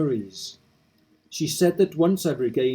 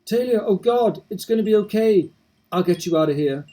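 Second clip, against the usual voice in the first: high, louder voice of a panicked husband; frantic husband; 7 semitones higher, 4 dB louder than usual. Acted. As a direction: rush in trying to save her. Kenneth voiced during rescue.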